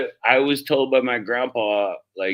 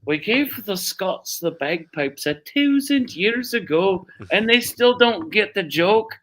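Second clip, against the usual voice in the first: slow, higher put-on Irish accent; 8 semitones higher, slower than usual. Old Irishman teasing about bagpipes, slow mock Irish accent. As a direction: deliver the joke in a slow Irish lilt. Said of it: "and he says".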